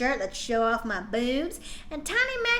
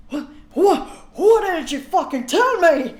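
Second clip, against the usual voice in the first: louder hammed-up yelling Draco; mock shout; 4 semitones higher, 8 dB louder than usual. Draco yelling at Ebony for hiding it, mock shout. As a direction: bellow it like a betrayed boyfriend. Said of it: "he shouted".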